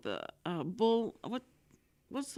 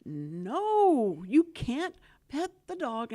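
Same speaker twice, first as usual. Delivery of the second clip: firm warning; 5 semitones higher, 4 dB louder, much slower than usual. Retold or imitated. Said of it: "she goes".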